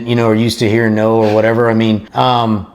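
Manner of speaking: ordinary talk, nothing marked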